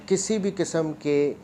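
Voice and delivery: ordinary talk, nothing marked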